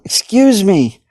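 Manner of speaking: high, loud desperate pleas; yelling. A narrator's reading. Stuck man yelling at passing guard, desperate. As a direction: shout at the guard, begging. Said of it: "A guard walked by and he yelled".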